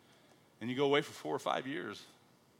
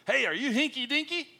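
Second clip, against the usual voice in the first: high-pitched hammed-up greeting; mock an over-keen missionary calling out high; eager newcomer; 12 semitones higher, 4 dB louder than usual. Missionary trying to fit in with Australians, high eager question. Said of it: "So he goes around and says".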